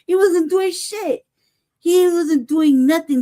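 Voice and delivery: emphatic, drawn-out protest; slow insistence. Real, not acted. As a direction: drive home that he did nothing. Insisting he did nothing.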